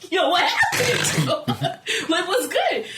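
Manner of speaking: high, loud, cracking up; laughing disbelief. Retold or imitated. Storyteller re-enacting her shock at how fast it ended, laughing. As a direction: ask it through laughter, incredulous.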